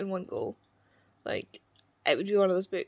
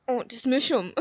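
gushing, louder and higher; 4 semitones higher, 6 dB louder than usual. Real, not acted. Vlogger to viewers, gushing over the cute hero. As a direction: squeal over how cute he is. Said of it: "he makes me feel violent".

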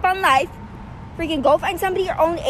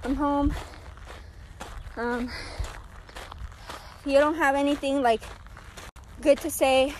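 subdued and upset; 3 semitones lower, 8 dB quieter than usual. Real, not acted. Upset after another incident.

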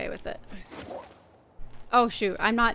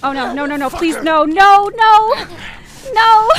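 pleading, rising to a loud, high cry; panicked pleading, building to a yell; mounting panic; 6 semitones higher, 9 dB louder than usual. Real, not acted. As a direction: beg louder and higher with each no. Panicking as the game threat closes.

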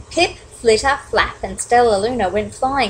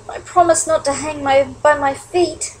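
mild weary sigh, pitched up; a touch of resignation; 5 semitones higher than usual. Acted. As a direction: sigh it out, slowing at the end. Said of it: "Stella Luna sighed".

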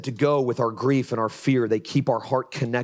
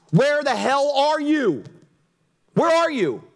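higher, louder cry to God; desperate prayer; 11 semitones higher, 4 dB louder than usual. Retold or imitated. Recalling his own prayers in hard seasons, raw desperate cry. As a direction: call out to God as if abandoned. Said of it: "that's all I prayed".

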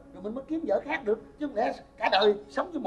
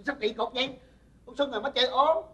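usual speaking voice

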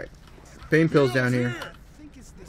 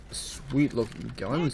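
slow, softer, relishing the words; savouring drawl; 6 dB quieter, slower than usual. Real, not acted. Player on the way to some guns, savouring drawl. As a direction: draw out "sweet looking guns" with relish.

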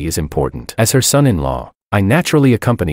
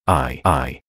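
put-on halting, hesitant child; stammering; 6 semitones lower, 4 dB quieter than usual. A narrator's reading. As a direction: stumble over the words unsure. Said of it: "Simon stammered".

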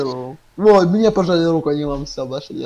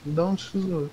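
ordinary delivery

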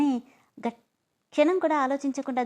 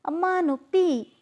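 high, slow yelp of pain; drawn-out cry of pain, pitched high; 7 semitones higher, 4 dB louder, slower than usual. Acted. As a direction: cry out as your arm is twisted. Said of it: "అని అరుస్తుంది".

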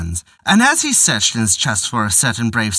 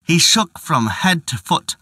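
ordinary delivery, nothing marked

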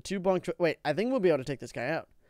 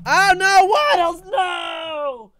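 high, very loud cry of dismay; drawn-out high yell of alarm; panicked shout; 13 semitones higher, 12 dB louder, much slower than usual. Real, not acted. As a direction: scream out in horror as your favorite goes down. Game character Waddles in danger.

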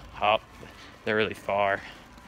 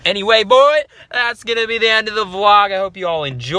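youtuber outro; 9 semitones higher, 9 dB louder than usual. Acted. Vlog outro host voice.

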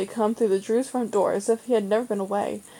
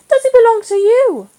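put-on high, loud eager child's question; excited; 14 semitones higher, 12 dB louder than usual. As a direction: ask it bursting with excitement. Excited boy greeting the dog.

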